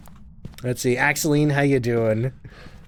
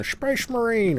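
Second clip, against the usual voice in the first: a mock Cockney "Space Marine", pitched up high; bad Cockney accent; 10 semitones higher, slower than usual. Teasing Ray with a terrible Cockney accent. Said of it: "or whatever that accent is".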